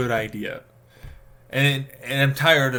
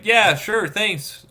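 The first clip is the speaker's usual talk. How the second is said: sarcastic thanks; 9 semitones higher, 6 dB louder, much slower than usual. Acted. Sarcastic reply to useless advice.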